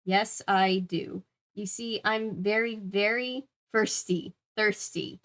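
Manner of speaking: put-on slow, deliberate tipsy girl; every word placed carefully, as if drunk. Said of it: "In a slow, careful voice".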